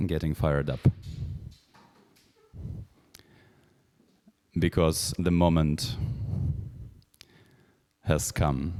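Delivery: slow, soft, suspenseful announcer; hushed dramatic build-up. Acted. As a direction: draw out the tension quietly.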